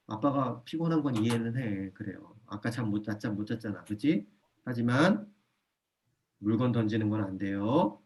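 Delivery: soft and steady, then a gentle no; calm firm parent. Shown as a demonstration. Father telling his child not to throw things, calm and firm. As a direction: kindly draw the line.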